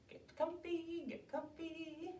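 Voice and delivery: high, soft, drawn-out singsong; soft settling coo. Real, not acted. Settling children before the story.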